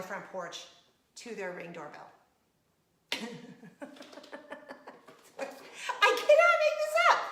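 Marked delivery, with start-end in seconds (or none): 3.1-5.1 soft, breathless giggle fit; real wheezing laugh; helpless laughter. Real, not acted. Cracking up at her story. 6.0-7.2 very high, loud, rapid squeak; real overcome-with-laughter outburst; hilarity squeal. Real, not acted. Cracking up at her own story.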